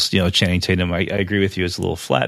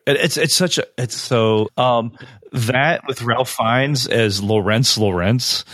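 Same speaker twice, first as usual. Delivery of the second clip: ordinary delivery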